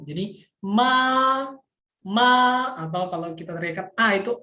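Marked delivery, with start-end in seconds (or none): 0.7-2.3 MA held high and level; long, high, unwavering MA in Mandarin first tone. Shown as a demonstration. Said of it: "nada satu itu dia posisinya tinggi terus datar". 3.9-4.1 A held high and level; long high A without any rise or fall; first tone A. Shown as a demonstration. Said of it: "tinggi terus datar".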